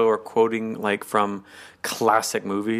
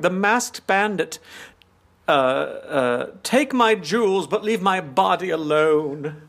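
high, slow, swooning damsel voice; damsel in distress; 7 semitones higher, slower than usual. Retold or imitated. Mister Humphreys teasing masked Peacock, as a fluttery damsel. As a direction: flutter it out like a frightened lady. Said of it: "like him pretending to be a damsel in distress".

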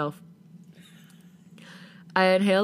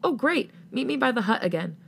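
higher, brightened-up reply over the headset; pleased friend; 6 semitones higher than usual. A narrator's reading. Friend welcomes player back to the game, pleased. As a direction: voice the friend, pitched well up.

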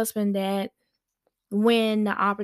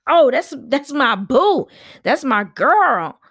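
loud, high, fake sweet praise; mock gushing friend; 4 semitones higher, 10 dB louder than usual. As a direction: gush over her like a phony friend.

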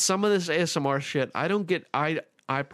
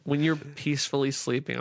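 ordinary talk

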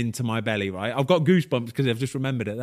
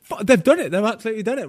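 much higher, louder, overjoyed; thrilled agent shouting they've done it; excited; 10 semitones higher, 5 dB louder, faster than usual. Retold or imitated. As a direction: squeal the news like it's unreal. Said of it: "He was like".

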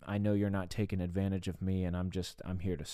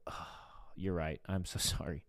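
softer, sighing admission; contrite apology; 3 semitones lower, 7 dB quieter than usual. Retold or imitated. Husband to wife after being rude, softly apologetic. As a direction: give in softly and own the fault.

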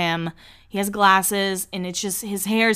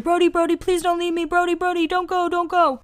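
high-pitched, whiny begging imitation; mock pleading; 10 semitones higher than usual. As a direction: beg him in a high, held-up voice. Friend chases him, begging him to stay.

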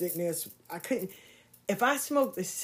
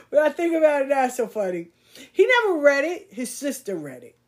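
amused; 8 semitones higher, 8 dB louder than usual. Real, not acted. Amused at past self.

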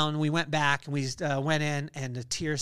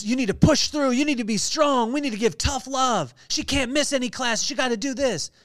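loud, higher and pushing, hammering every line; tough love voice; 7 semitones higher, 5 dB louder than usual. Retold or imitated. Voicing the world's advice to a father, loud tough-love push. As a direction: bark it like a hard-nosed coach who won't bend. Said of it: "what the world would have taught me".

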